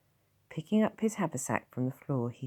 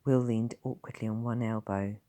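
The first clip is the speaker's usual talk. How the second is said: usual speaking voice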